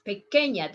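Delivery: pequeña with a clear ñ, like ny; careful pequeña. Shown as a demonstration. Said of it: "listen how I pronounce pequeña".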